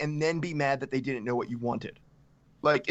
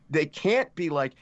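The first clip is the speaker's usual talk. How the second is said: usual speaking voice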